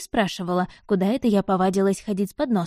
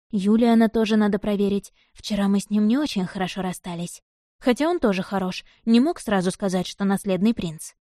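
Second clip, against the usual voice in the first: ordinary delivery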